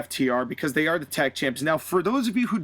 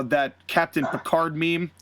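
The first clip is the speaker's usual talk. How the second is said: normal talking voice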